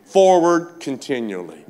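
loud, high, driving sermon delivery; full-force preaching. Real, not acted. Preacher urging believers past obstacles, full-force preaching. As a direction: preach it big, then stretch out the last words.

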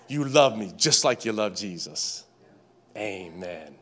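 low, soft prayerful voice; murmured to God, quiet and low. Acted. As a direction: speak it as a hushed prayer.

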